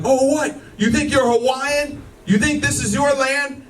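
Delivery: mock heckling homeless woman; imitating a woman screaming at him on the street; homeless woman yelling. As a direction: jeer at him, mock taunting. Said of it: "She was like".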